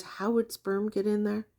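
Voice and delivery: usual speaking voice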